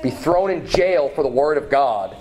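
usual speaking voice

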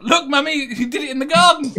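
put-on high, loud, proud little kid; excited child. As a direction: shout it like a thrilled four-year-old. Child voiced in retold story.

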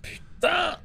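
sudden high yelp of surprise; startled shout. Real, not acted. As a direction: jump and yelp. Cri soudain en jouant. (juron surpris et aigu)

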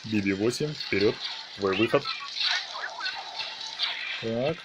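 normal talking voice

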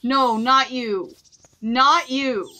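firm, loud telling off; angry. Real, not acted. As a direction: scold it away loudly. Shooing animal from garden.

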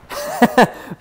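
short burst of high laughter; high cackle. Real, not acted. Player amused by Banana Ruto, high cackle.

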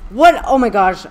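loud, high startled yell; sudden shout that falls away; shocked outburst. Real, not acted. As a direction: blurt it out in disbelief.

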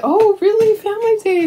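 surprised. Real, not acted. Reacts to a viewer comment.

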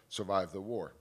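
ordinary delivery

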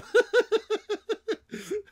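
long, high, loud laughing fit; real helpless cackling laugh; giddy laughter. Real, not acted. Gamer needing a nap after the trailer, giddy laughter.

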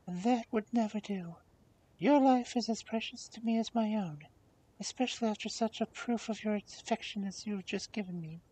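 high, affectionate princess voice; tender princess. Acted. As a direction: refuse kindly in a high princess voice.

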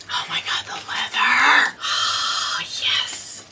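breathy, drawn-out swooning whisper; awed whisper. Real, not acted. Vlogger swooning over the leather, soft awed whisper. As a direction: whisper it softly, savouring with a sigh.

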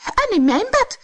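very high, loud exclamation; high-pitched storybook outcry; dwarfs crying out. A narrator's reading. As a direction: pipe up like tiny indignant dwarfs. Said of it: "riepen de tweede".

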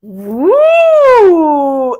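loud high cheer; excited woo. Real, not acted. Teacher cheering her class, loud and excited. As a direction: whoop like the class just won. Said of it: "Excited, huh?".

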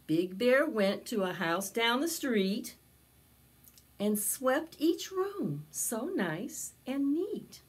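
put-on slow, lively read-aloud for children; storybook narration. A narrator's reading. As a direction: tell it to a circle of little kids. Storytelling continues.

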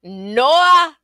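high, loud, swooping cry; shouted refusal. A narrator's reading. Little man rejecting the last guess, shouted. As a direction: holler it, rising on the long vowel.

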